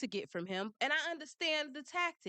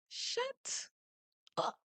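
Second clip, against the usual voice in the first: mock shut up; 12 semitones higher than usual. Telling off the lawyer.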